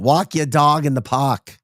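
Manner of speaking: hammed-up Boston "pahk"; Boston accent. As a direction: drop the R's like a Bostonian.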